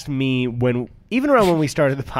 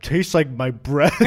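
slow punchline dissolving into laughter; laughing; 3 semitones higher, slower than usual. Real, not acted. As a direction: laugh as you say it.

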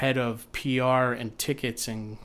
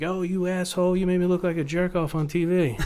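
higher, mock-indignant yell at the producer; angry stranger; 6 semitones higher than usual. Casting guy imitates an angry ex-contestant, mock outrage. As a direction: play the furious reality show contestant. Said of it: "people are like".

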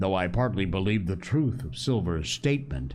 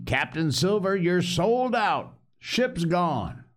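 higher, slow, pointed; quoting the doctor; 10 semitones higher, slower than usual. Retold or imitated. Silver mimics the doctor announcing the ship's loss. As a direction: play the doctor's blunt news lightly. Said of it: "Says he".

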